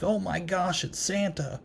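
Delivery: high, hushed fangirl gush; mock fangirl freak-out. Reviewer mimicking the clown spotting Santa at the bar, hushed fangirl squeal. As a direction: imitate a starstruck fangirl, high but soft. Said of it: "freaking out like a fangirl".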